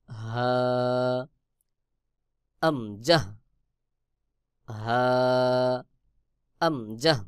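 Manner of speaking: হা and আমজাহ both from the start of the throat; letter demo. Shown as a demonstration. Teacher contrasting হা and হামজা for the students. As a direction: say হা then আমজাহ, from the same spot in the throat. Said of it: "যেমন".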